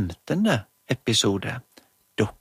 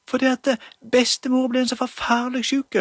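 much higher, louder pleading mum; urgent mother; 11 semitones higher, 4 dB louder than usual. Acted. Mother begs doctor to come, high and urgent. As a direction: urge the doctor to hurry. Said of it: "Det var Julie som snakket for mammen".

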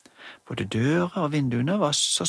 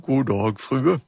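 louder, gruff hallo; gruff doctor voice; 4 dB louder, slower than usual. Acted. Emma as doctor answers phone, gruffly. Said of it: "hun gjorde seg veldig grov i målet".

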